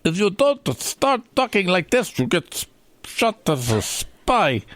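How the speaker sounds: a failed mock Russian that turns German; horrible German accent. The host tries Yuri's line, in a horrible German accent. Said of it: "That is horrible German".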